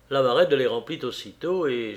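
plain reading voice

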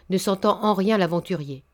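usual speaking voice

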